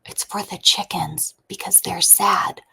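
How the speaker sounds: put-on whispered child's explanation; hushed secret between kids. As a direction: whisper it confidentially to your sister. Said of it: "She whispers it to her".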